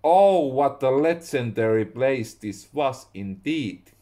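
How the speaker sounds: plain reading voice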